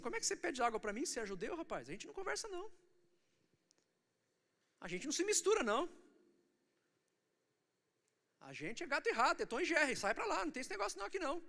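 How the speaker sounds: high-pitched, quick colloquial protest; mock indignant rebuff. Voices Samaritan woman rebuffing Jesus.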